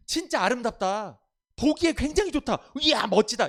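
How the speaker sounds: loud, high, gasping wow over a beautiful sight; gushing admiration. Retold or imitated. Pastor mimics a wrong reading of God's words, gushing. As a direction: rave about how lovely it looks. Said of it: "감탄하고 그게 아니에요".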